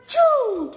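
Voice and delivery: loud high sneeze, far from the own voice; bear sneeze. A narrator's reading. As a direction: let out a huge bear sneeze. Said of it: "el oso hace". (estornudo fuerte de oso)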